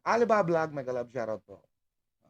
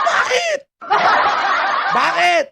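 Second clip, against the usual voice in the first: loud, high one-word challenge; indignant demand; 5 semitones higher, 5 dB louder, slower than usual. Real, not acted. Vlogger challenging the angry siblings, indignant demand. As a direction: fire the question out, raised and forceful.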